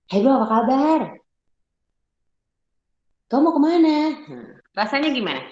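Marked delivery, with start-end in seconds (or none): 0.1-4.0 bright, loud friendly greeting with a smile; smiling greeting. Shown as a demonstration. Teacher greets the class with a smile, loud and friendly. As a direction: greet them grinning, warmly. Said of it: "Senyum, senyum".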